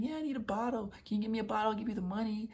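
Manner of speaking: put-on high, pleading girlfriend voice. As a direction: ask sweetly for a favor, pitched well up. Ex-girlfriend voiced asking for bottle.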